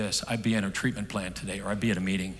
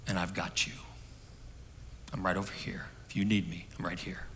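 put-on softer, hushed comfort to a crying child; tender reassurance; 8 dB quieter than usual. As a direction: lean in close and reassure her gently. Father comforts sobbing daughter close.

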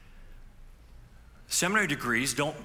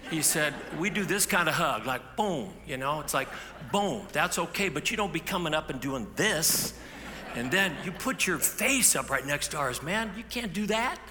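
put-on teasing young man; 6 semitones higher than usual. Derek mocking the pastor's hugs, higher and joking. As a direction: tease the pastor like an old friend. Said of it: "He goes".

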